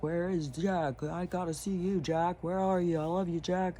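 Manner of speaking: a hint of Trump calling out fondly for Jack; Trump impression. Trump on a show calling for his friend Jack, lightly gushing impression. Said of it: "with Trump talking about how much he likes Jack".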